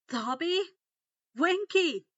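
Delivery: loud, high frightened calls; fearful calling out. A narrator's reading. Harry calls the house elves in fear, loud and high. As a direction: call for help in a panic. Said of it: "Harry called out fearfully".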